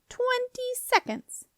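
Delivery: very high, stretched-out sing-song; squeaky doorkeeper toying with them; taunting squeak. A narrator's reading. Doorkeeper counting down the time, taunting. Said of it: "came the doorkeeper's taunting squeak".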